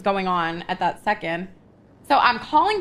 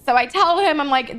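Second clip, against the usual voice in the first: usual speaking voice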